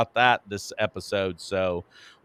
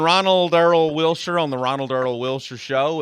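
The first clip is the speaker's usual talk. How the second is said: loud, high announcer opening; show host intro; 4 semitones higher, 6 dB louder than usual. Acted. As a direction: kick off the show big.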